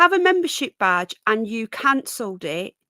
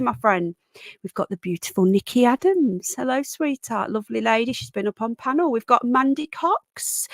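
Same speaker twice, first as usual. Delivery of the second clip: ordinary delivery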